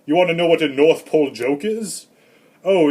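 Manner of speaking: ordinary delivery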